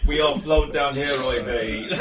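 ordinary delivery

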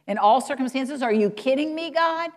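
high, disbelieving cry to God; incredulous protest. Acted. Preacher voicing doubter's protest to God, incredulous. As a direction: protest as if it can't be true.

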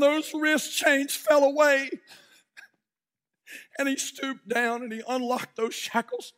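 loud, rising sermon storytelling; fiery revival preacher telling a story; preaching at full force. Acted. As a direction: preach it to the whole room. Preacher tells slave auction story.

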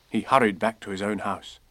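usual speaking voice

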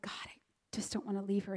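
soft fast whisper, voice dropped away; whispered prayer. Retold or imitated. Mother whispering to God the night before leaving her baby. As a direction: breathe the plea to God, barely audible.

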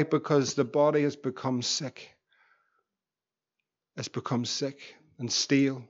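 low, soft, intimate sermon voice; hushed heartfelt appeal from the pulpit; quiet earnest preaching. Acted. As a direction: lean in and plead gently with the congregation. Quiet intense preaching close.

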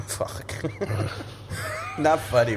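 breathy shocked laughter; giggling through shock; laughing. Real, not acted. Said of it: "Why are you laughing then?".

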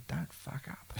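a hushed, breathy voice from beyond; ghostly whisper. Retold or imitated. Musician retelling a dream message, hushed ghostly voice. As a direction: pass on the dead man's words soft and eerie. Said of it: "And she said, he said".

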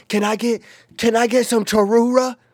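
put-on high, loud drunken call; hammed-up drunk friend shouting an order high; imitated drunk order. Drunk Alec ordering at drive-thru, high mock yell.

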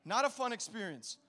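ordinary delivery

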